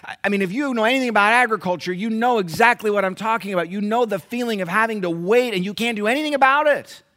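high, loud preaching to the church; preaching at full force. Acted. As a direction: drive it home to the congregation. Preacher addressing congregation.

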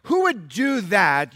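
loud, high, building pulpit delivery; fiery sermon climax; full-force preaching. Acted. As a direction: hammer the rhetorical questions home.

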